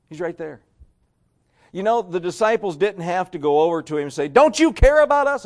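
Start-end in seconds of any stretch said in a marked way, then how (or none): 4.3-5.5 much higher, louder frantic cry; angry yell. Retold or imitated. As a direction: shout it at the sleeping master in panic. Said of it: "yell at him and get mad at him".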